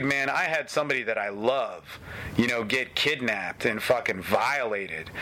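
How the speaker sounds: ordinary talk, nothing marked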